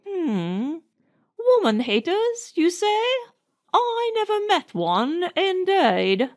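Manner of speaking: put-on high flustered old nanny voice. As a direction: pretend shock, secretly pleased. Nanny aghast but delighted.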